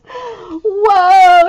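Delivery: usual speaking voice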